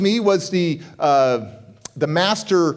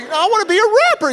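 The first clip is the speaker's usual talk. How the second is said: high, fast, pleading insistence; insistent excitement; 4 dB louder, faster than usual. Retold or imitated. Insists against friend's doubt.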